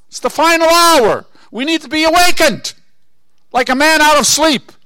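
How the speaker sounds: high, loud rising exhortation; fervent preaching. Real, not acted. Preacher urging sleepy congregation, fervent high loud cry. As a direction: cry out to wake the church.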